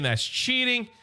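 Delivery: high-pitched retold protest; mock scream. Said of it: "Starscream is screaming".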